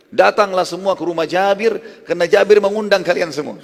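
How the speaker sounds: loud, high public summons; imitating a shouted invitation from a height; retold shout. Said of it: "berdiri di atas batu yang tinggi teriak".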